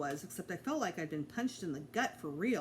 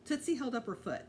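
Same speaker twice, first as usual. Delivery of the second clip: plain reading voice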